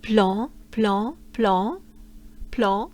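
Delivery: plan nasal with a rising tune; French plan together. Shown as a demonstration. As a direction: repeat plan after a breath. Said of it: "Take a good breath".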